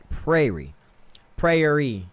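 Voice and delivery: "prairie" slowly tried as PRAY-ree; careful, separated "prairie", PRAY-ree; practised correct pronunciation. Real, not acted. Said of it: "There we go".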